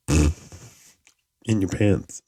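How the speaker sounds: deadpan punchline. Pretend. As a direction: drop the punchline slowly and flatly. Punchline of joke.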